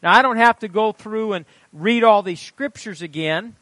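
loud, high teaching from the pulpit; emphatic preaching. Real, not acted. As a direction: declare the point to the congregation. Preaches at congregation.

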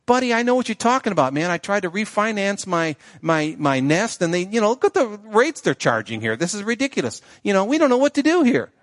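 a fussy little bird grumbling about prices; chatty cartoon bird. Second bird answers in the skit.

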